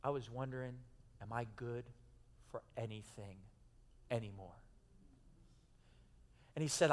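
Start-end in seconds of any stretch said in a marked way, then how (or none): 0.0-4.5 quiet, hushed retelling of a weeping man; retold tearful words. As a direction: let the voice sink almost to nothing, moved. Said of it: "and he said this with tears down his face".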